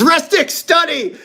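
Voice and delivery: sudden high shout of joy; excited shout. Real, not acted. Opening packs, he shouts at his dream card in delight. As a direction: yell the card name like you just won.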